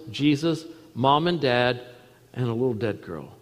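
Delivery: usual speaking voice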